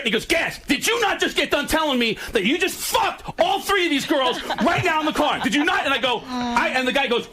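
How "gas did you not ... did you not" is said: accusing shout. Retold or imitated. Friend grabbing him by the shirt, an accusing shout. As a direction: yell it in his face, grabbing his shirt.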